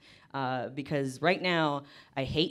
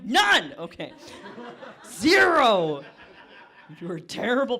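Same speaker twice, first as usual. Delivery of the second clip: loud mock scolding shout; 4 semitones higher, 8 dB louder, slower than usual. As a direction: yell it like a scolding.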